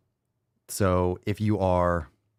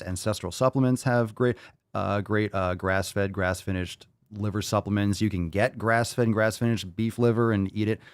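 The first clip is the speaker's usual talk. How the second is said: ordinary delivery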